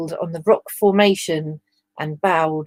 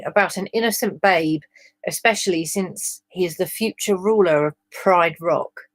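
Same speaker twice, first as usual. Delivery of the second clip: usual speaking voice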